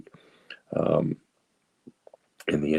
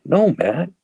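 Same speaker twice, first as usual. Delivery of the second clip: high-pitched, louder protest of innocence; indignant denial; 13 semitones higher, 4 dB louder than usual. Retold or imitated. Recovering drinker answering his friend's drug questions, indignant denial. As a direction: deny it emphatically, pitch jumping up.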